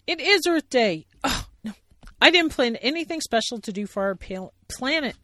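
loud, high preachy bear; Sally Bear voice. Acted. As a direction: lecture him, then panic. Sally lecturing then worried.

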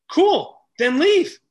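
high, loud, emphatic comeback; punchy mock-cheerful challenge; blunt retort. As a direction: dismiss the complaint with gusto. Retorting to unwilling cashier.

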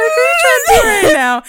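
high, loud mock disbelief; incredulous teasing. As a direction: squeal it in playful outrage. Teasing Captain America.

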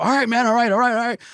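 high, loud frantic pleading; mock begging. Beaten man to his attacker, high loud pleading. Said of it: "He's begging me to stop".

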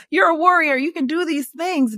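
high, rallying self-encouragement; pep talk to herself. Retold or imitated. Mom hyping herself up through healing, high and rallying. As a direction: pump yourself up like a cheerleader. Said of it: "I'm like".

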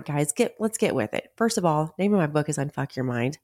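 ordinary delivery

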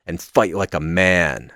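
normal talking voice

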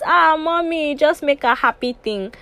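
a hint of a wheedling vendor; lightly voiced seller. Ice cream man selling to a mother, lightly acted coaxing. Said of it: "he was supposed to do that market".

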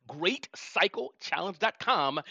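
spelled out slowly, word by word; slow, clear repeat. Shown as a demonstration. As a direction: say "Great cycle challenge dot com" slowly for a bad line. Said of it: "so I'm gonna have to say that again".

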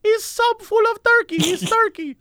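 high-pitched put-on woman's voice; poor little woman. Subway worker defends the sandwich to customer, poor little woman. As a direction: insist helplessly in a little high voice. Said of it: "And she's like".

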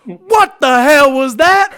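high, loud, slow outraged yell; furious chef shout. Pretend. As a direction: roar it at the whole kitchen. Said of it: "he goes".

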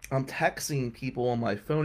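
ordinary talk

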